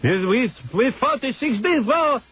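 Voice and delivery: put-on high, slow boastful Israeli accent; imitating an Israeli boss. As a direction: brag like the arrogant Israeli boss.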